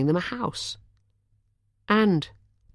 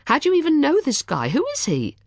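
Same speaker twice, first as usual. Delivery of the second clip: indignant woman; 12 semitones higher, 3 dB louder than usual. A narrator's reading. Charlotte demanding answers.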